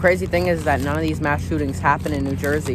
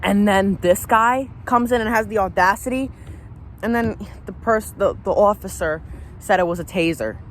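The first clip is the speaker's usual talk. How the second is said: rising, heated anger; mad; 4 semitones higher than usual. Real, not acted. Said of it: "this makes me mad".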